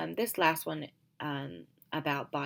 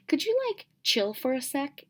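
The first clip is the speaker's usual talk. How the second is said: put-on very high, louder bratty teen voice; juvenile teen; 11 semitones higher, 6 dB louder than usual. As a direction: sound like a snippy teenager. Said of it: "you can just tell that she's juvenile".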